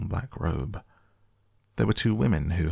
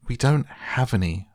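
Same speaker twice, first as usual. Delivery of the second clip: put-on higher-pitched girl's protest; crying out; 6 semitones higher, 4 dB louder than usual. A narrator's reading. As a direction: cry it out in protest. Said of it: "Violet cried".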